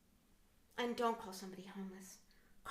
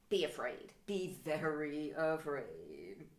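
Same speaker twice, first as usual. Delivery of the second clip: mock horror trailer voice; 6 semitones lower, much slower than usual. Movie-trailer quote.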